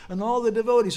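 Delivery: ordinary talk, nothing marked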